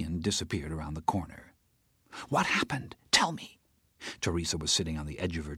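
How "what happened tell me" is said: breathless urgent whisper. Acted. Teresa presses the narrator in an urgent whisper. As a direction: lean in and whisper for the secret.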